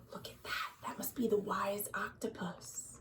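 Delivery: hushed, amazed whisper; awed whisper. Acted. Reader marvelling at the octopus to the listening children, hushed. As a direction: breathe it like you've spotted something wondrous.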